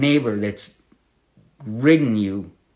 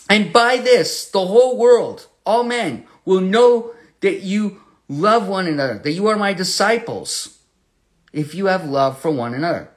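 raised preacher's voice; preaching at full force; 6 semitones higher, 4 dB louder than usual. Real, not acted. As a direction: ring it out to the congregation. Proclaims verse at full force.

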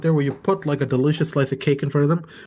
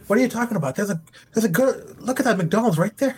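higher-pitched playful disbelief; mock incredulous scolding; 7 semitones higher than usual. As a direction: act baffled at the healthy eater. Acts out scolding a healthy eater.

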